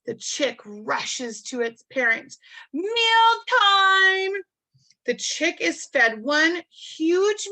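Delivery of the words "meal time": loud, high-pitched sing-song call. Acted. Penguin parent calling the rushing chick to food, sing-song call. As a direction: holler it high, holding the last word long. Acts out the call to eat again.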